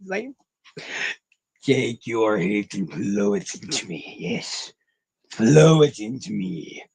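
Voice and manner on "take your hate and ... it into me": slow, low, relishing villain voice; mock villain. Streamer playing a hate-hungry villain to chat, slow and low. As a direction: savor the hate like a cartoon villain.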